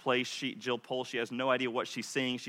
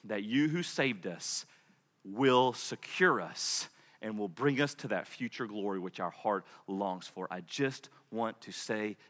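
soft, low, prayerful closing; hushed prayer; 5 semitones lower, 5 dB quieter than usual. Acted. Pastor softly praying to close the sermon. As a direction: pray gently over the congregation.

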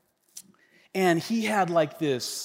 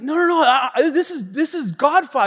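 high, loud, flustered objection; insistent mock protest; 8 semitones higher, 7 dB louder than usual. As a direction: imitate a friend stubbornly protesting, loudly. Friend protests, acted argument.

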